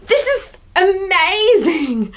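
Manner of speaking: high, drawn-out overjoyed squeal; hammed-up, almost tearful delight; excited inner voice. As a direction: act out being blown away. Acting Thomas's inner reaction.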